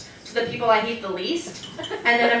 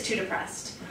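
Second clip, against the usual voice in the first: deadpan excuse; 3 semitones lower, 12 dB quieter than usual. Pretend. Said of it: "flat excuse reply".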